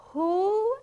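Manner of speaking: high, rising "who?"; clueless questioning child. Acted. Bunny asks his father who is meant, puzzled.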